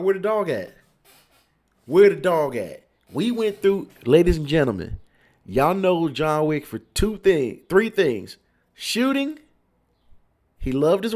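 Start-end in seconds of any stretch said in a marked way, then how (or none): 0.0-2.8 high, then louder, calling out; demanding outburst. Retold or imitated. At the movies with her bestie, loud demanding outburst. 8.7-9.4 high, bursting emphasis; excited. Real, not acted. To the viewers, listing his three things, high excited burst.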